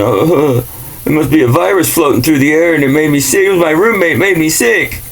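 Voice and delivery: high, fast mocking voice of a gullible person; a high mocking parody of people blaming a virus; mocking sheep voice. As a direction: sneer as you parrot the naive excuse.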